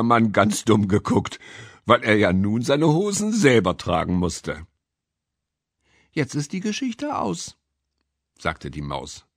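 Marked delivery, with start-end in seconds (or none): 6.1-7.6 high little mouse voice; high-pitched, unforced mouse; mouse character. A narrator's reading. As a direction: speak as a small storytelling mouse. Mouse character closing its story.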